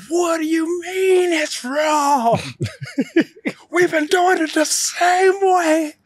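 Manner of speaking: high, slow furious shout; snapping. Retold or imitated. Fourteen-year-old student snapping at his teacher, a high slow shout. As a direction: scream it at the teacher, losing it. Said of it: "I snapped".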